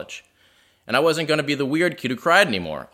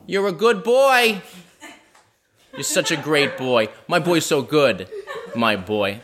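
mom impression; slower than usual. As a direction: coo over your son like a proud Boston mother. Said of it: "This is a spot-on impression of my mother".

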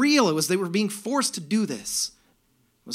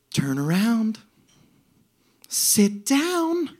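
sweet angel's singsong, lifting higher; angelic, joyful; 10 semitones higher, much slower than usual. Retold or imitated. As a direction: chant it like a delighted angel greeting riders. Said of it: "the voice of an angel".

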